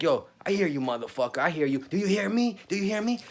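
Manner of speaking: shouting at the hidden beast; yelling challenge. Retold or imitated. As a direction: call out into the woods to scare it off. Yelling at unseen monster in forest.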